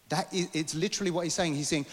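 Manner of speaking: ordinary talk, nothing marked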